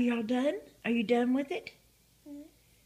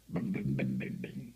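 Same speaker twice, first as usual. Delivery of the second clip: low rumbling booms. Acted. Playing with toddler, sound effect.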